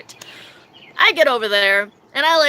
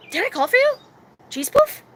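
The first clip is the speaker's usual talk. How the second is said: very high little questioning voice; dazed repeating; 12 semitones higher than usual. Retold or imitated. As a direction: imitate your stoned self, innocent and puzzled. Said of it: "continuously repeating everything around me".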